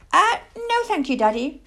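high little owl voice; politely wriggle out of it as a young owl; piping, hesitant child-owl refusal; reluctant owlet. Acted. Plop declines going into the dark.

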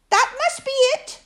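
small, high, squeaky little piglet voice. Acted. Narrator voicing Piglet fretting about the trap, high and squeaky. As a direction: squeak it out high like a tiny worried animal.

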